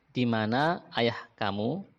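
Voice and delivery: ordinary talk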